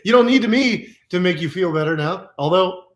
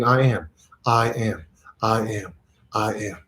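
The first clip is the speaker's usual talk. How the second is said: slow, low, fading much softer; repeated mantra; 6 semitones lower, 4 dB quieter than usual. Shown as a demonstration. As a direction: chant I am ever quieter. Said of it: "you just keep repeating".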